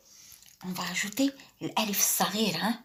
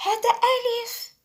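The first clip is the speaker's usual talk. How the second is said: 1 high demo voice; 14 semitones higher, faster than usual. Shown as a demonstration. Teacher models letter with raised voice.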